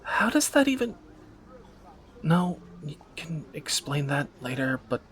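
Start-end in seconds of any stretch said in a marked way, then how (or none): 0.0-2.6 slow, then a high, loud astonished "What?"; disbelief. Acted. Reacting to a partner buying smoke bomb fuel, astonished. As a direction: let the shock break through the question. Shocked by partner's shopping list.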